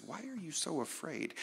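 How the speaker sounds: Jesus gently asking his frightened friends; gentle question. Retold or imitated. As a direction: ask it tenderly, almost under the breath. Jesus speaks after calming storm.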